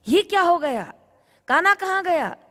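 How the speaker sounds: high, loud startled cry; shocked. Acted. Gopi finding her own son under the veil, high and stunned. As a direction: gasp in disbelief at the wrong child.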